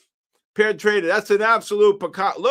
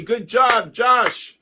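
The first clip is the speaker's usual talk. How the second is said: excited praise; 6 semitones higher, 5 dB louder, much slower than usual. Real, not acted. Praising a good call.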